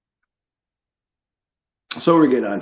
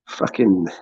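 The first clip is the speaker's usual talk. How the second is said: ordinary delivery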